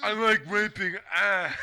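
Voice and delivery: high, loud, dragged-out words; hammed-up drawling caricature; mocking character impression. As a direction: put on a slow goofy voice.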